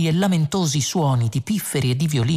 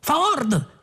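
high-pitched call; 12 semitones higher than usual. A narrator's reading. Arthur searching desperately for Ford, calling out. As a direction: call the name high and urgent. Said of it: "chiamò poi".

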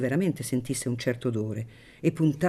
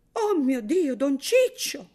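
high, louder cry of dismay; horrified exclamation; 12 semitones higher, 4 dB louder, slower than usual. A narrator's reading. The woman shocked by Paglietta's claim, dismayed cry. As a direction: cry out, hands to heaven. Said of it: "esclamò la donna alzando le due mani".

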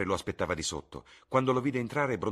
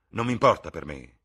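loud, sharp exclamation; exclaimed; 12 dB louder than usual. Acted. Son bursting out at his mother, loud. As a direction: burst out, angry. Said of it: "esclamò".

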